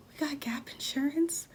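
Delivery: high, tentative little voice; mock timid reply. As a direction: offer it hopefully to an angry mom. Retold timid reply to mad mom.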